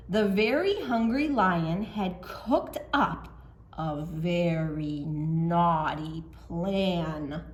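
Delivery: ordinary delivery, nothing marked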